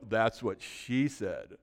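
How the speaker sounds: low, slow suggestive quip; sly innuendo. Acted. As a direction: draw it out low, insinuating. Acts someone adding innuendo.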